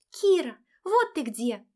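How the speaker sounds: put-on high, bright girlish chirp; chirpy ringing voice. A narrator's reading. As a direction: chirp it like a cheerful young friend. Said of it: "прощебетала подруга звонким голоском". (звонкий щебечущий голосок)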